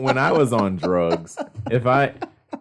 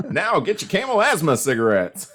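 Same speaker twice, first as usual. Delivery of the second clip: chipper 1950s ad; 9 semitones higher, 4 dB louder than usual. Retold or imitated. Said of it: "they'd have commercials".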